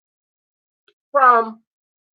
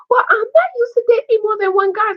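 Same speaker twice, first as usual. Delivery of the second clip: mocking Nina; 12 semitones higher than usual. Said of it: "She said".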